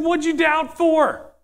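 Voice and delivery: high, slow, exasperated question; acted scolding. Jesus scolding Peter in the boat.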